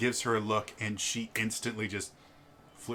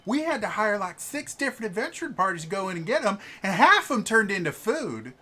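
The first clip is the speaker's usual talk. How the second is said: high, loud, fast rambling; gushing noblewoman; 11 semitones higher, 8 dB louder than usual. Acted. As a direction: blurt out a farm story with glee. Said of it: "the woman, uh, goes".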